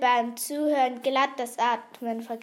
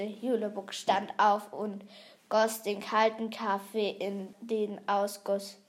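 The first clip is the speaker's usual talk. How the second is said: ordinary delivery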